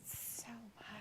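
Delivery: sarcastic whispered aside. Pretend. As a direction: breathe it out deadpan. Sarcastic whisper about gripping match.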